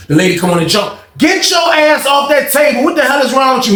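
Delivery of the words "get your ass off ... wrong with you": mock officer yell. Guard scolding the man on the table, mock yell. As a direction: bark it like the lady guard.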